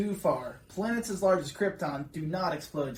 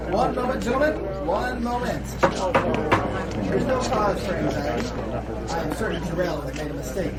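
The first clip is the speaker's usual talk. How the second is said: louder, commanding reassurance; council leader Rosan; 4 dB louder than usual. Acted. Rosan calming the alarmed council, louder and commanding. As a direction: settle the room firmly.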